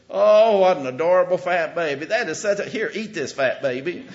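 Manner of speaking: put-on high, loud gushing baby talk; cooing over baby. Church folks gushing over the preacher's chubby baby, cooing loudly. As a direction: gush over the baby like a doting stranger.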